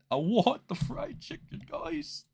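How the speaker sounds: high-pitched mock begging; pleading. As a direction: plead playfully, voice climbing very high. Playful plea repeated in higher voice.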